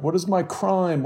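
high, pleading cry; cried out. A narrator's reading. Bloodied man pushed into the street, pleading cry. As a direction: plead with the crowd for an answer. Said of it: "as he cried".

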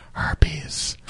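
hushed, voiceless mock whisper; retold whisper. Patient admitting it to the clinic lady, embarrassed whisper. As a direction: whisper it so nobody else can hear. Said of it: "and I whispered".

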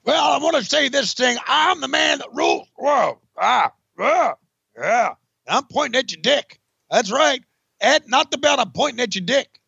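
ranting like a crazed big man. Pretend. [name] voices [name] cutting promo.

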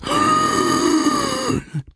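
high, wheezy gasp for air; long drawn-in wheezing gasp; imitated gasp. Imitates daughter struggling to breathe.

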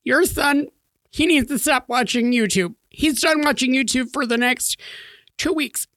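high-pitched mock of a cross wife, in halting bursts; imitating upset wife. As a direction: mimic an indignant mother laying down the law up high. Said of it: "now that's how she talks".

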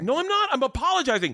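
very high, indignant mock protest. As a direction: squeal defensively as an exasperated wife. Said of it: "Now you're raising your voice".

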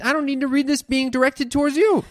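firm, fed-up objection; indignant. Real, not acted.

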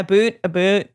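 "boot, a boot" for about, in mock Canadian. Shown as a demonstration. Correcting the Canadian example, exaggerated "a boot". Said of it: "with the Canadian".

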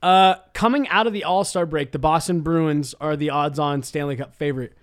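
ordinary talk

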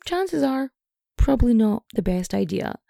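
usual speaking voice

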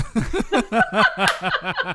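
big laugh. Real, not acted. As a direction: laugh out loud. Laughing at the joke.

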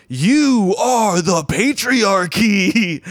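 drawn-out, high-pitched mock holler; mimicking a woman's loud accusing shout; imitated yell. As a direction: yell it slowly, stretching every word as a heckler. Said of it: "she just yells".

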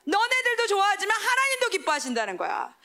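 high, ringing sermon climax; preaching at full force. Acted. Preacher urging the youth to win daily, full force. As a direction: proclaim it like a revival preacher.

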